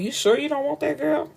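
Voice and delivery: high, needling question; mock suspicion. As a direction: tease with suspicion. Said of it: "It's like".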